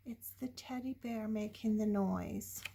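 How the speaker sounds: usual speaking voice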